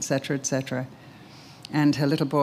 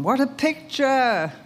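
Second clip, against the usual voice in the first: awed exclamation; 10 semitones higher, slower than usual. Real, not acted. Preacher marvels at the scene.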